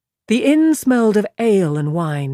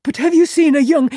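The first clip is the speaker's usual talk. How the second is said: higher, louder, eager question cut short; 10 semitones higher, 6 dB louder than usual. A narrator's reading. Perrin eagerly asking Simeon, higher and louder. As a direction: jump in eagerly before he stops.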